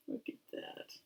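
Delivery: plain reading voice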